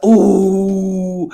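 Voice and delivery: normal talking voice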